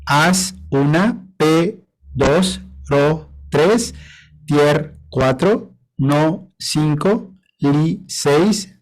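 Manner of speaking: each syllable paired with its count, rising; syllable count of the next verse. Shown as a demonstration. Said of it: "lo mismo con la siguiente".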